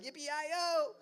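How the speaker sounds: high, hammed-up morning cheer; mock chipper cheer. Cheerful housemate greeting a groggy sleeper, hammed-up cheer. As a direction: bounce in like an over-eager early riser. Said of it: "you're coming in like".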